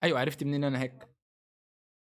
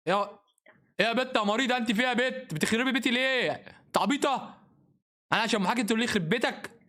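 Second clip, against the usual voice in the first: angry scolding; 8 semitones higher than usual. Real, not acted. يوبخ اللاعبة بصوت عالٍ. (توبيخ عالٍ بغضب)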